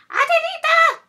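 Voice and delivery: spoiled sisters screaming a name. Acted. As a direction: scream for the maid from upstairs. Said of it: "they screamed".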